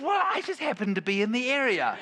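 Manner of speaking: high, laughing fake casual; mock innocent, laughing. Father retelling his planned rescue to the congregation, laughing. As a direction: play it innocent while cracking up.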